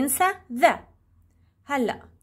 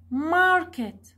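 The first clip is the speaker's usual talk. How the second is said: "Market" with MA stressed, higher pitch; 4 semitones higher than usual. Shown as a demonstration. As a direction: punch the MA of "Market", drop the end. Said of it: "It's ma".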